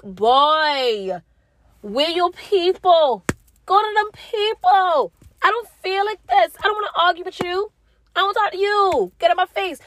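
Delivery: hammed-up exasperation, very high-pitched; fed-up parent. Mother telling son to get out her face, mock fed-up. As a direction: play up shooing a child away in a high voice.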